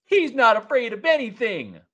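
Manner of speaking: put-on high, loud defiant kid; defiant child voice. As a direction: voice a bold little child. Children defend their leader.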